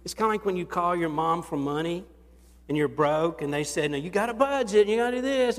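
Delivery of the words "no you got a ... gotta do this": higher, louder scolding mother; a hint of a strict mother; mock stern mom. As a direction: lecture like a mom refusing cash. Mom refusing money voiced.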